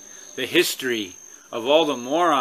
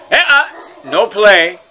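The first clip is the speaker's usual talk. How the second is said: loud, raised-pitch scolding; sharp dog command; 6 semitones higher, 10 dB louder than usual. Real, not acted. Owner telling dogs no play, sharp scolding. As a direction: cut off the dogs' play firmly.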